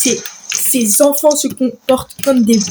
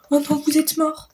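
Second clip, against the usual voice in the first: ordinary talk, nothing marked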